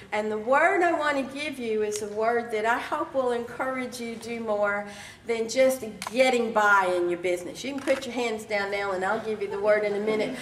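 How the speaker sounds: normal talking voice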